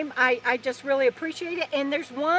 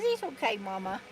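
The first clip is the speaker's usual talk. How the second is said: soft little dog voice, sweetly; 3 semitones lower, 7 dB quieter than usual. Pretend. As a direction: voice the dog gently and softly. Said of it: "He says".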